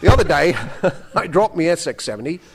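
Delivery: lightly attempted Australian accent. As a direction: read it with a rough Australian accent. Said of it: "do my Australian accent".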